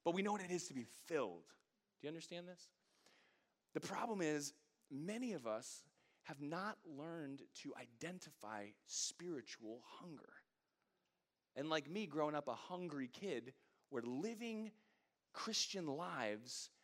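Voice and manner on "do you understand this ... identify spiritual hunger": ordinary delivery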